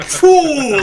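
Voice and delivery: loud, high "phew"; big high whoosh through laughter; imitated impact whoosh. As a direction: blurt a flying-through-the-air noise. Imitates flying into concrete.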